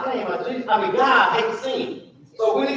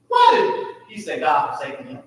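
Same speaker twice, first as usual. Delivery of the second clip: ordinary talk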